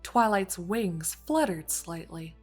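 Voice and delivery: ordinary delivery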